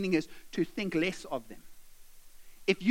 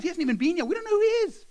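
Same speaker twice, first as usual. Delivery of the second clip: high-pitched exasperated complaint; acted outraged grumbling; indignant; 8 semitones higher, 3 dB louder, much faster than usual. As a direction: let the protest climb in pitch. Slave protests about Paul.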